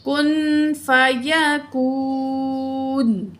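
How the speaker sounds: کن with hidden nasal ن, فیکون long و; nasalized, stretched. Shown as a demonstration. Teacher modelling ikhfa and a long vowel. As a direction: hide the نون in the nose, stretch کون. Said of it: "ناک میں چھپا کے پڑھیں گے".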